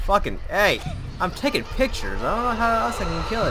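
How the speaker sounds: very high, loud, frantic questions; frantic high yelling while being chased; panicked shriek. Real, not acted. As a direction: panic while fleeing the monster.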